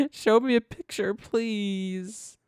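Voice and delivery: a high, whiny plea to the screen; mock pleading. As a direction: beg the website like a spoiled child. Begging the website for pictures.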